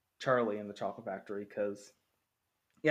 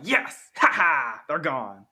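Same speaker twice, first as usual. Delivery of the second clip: gleeful cheer; 2 semitones higher, 9 dB louder, much slower than usual. Pretend. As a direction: cheer like the kids vanishing is a win. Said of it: "you can't be like".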